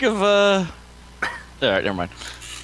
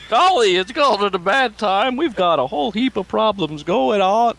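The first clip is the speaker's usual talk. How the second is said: put-on Southern accent; 3 semitones higher than usual. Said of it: "Now I went Southern".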